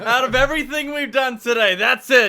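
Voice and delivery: loud, high, laughing indignation; mock outrage. Co-host mocking the joke that crossed a line, mock outrage. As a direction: blow up in hammed-up disbelief.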